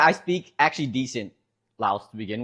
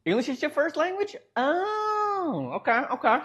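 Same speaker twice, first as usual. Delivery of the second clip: high, startled question, then a dawning okay; pitched-up double take at the news; real surprise; 12 semitones higher than usual. Real, not acted. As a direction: caught off guard, then accepting it. Learns chat's first language is English.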